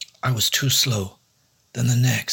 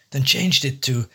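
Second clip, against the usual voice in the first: usual speaking voice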